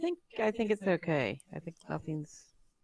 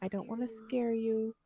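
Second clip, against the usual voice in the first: softer, slightly raised voice to a cat; hushed reassurance for a nervous cat; quiet, gentle coaxing; 2 semitones higher, 6 dB quieter than usual. Acted. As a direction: speak softly so the kitty isn't scared. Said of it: "go very quietly and say".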